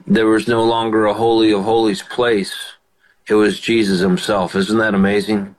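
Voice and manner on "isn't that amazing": ordinary talk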